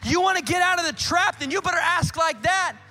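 loud, high full-force preaching. Acted. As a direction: drive it home to the congregation.

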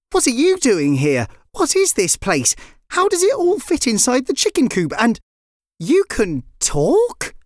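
high, incredulous questions; astonished questioning. A narrator's reading. Lyra questions the talking chicken, astonished. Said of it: "Lyra replied".

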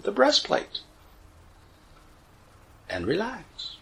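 measured cues with long pauses, softening on each relax; guided meditation. Acted. Teacher leading listeners through relaxing the body, guided meditation voice.

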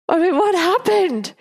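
high, stunned disbelief; shocked. Retold or imitated. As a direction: gasp it out in shock at the haircut. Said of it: "I was in such shock".

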